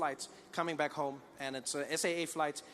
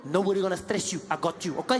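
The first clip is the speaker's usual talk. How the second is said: eager flight attendant; 3 semitones higher, 5 dB louder than usual. Retold or imitated. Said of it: "I said".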